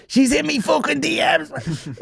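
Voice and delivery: squeaky and slow, laughing through it; high-pitched giggle as he says it; laughing at his own joke. Real, not acted.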